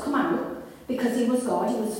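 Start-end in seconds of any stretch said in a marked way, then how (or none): none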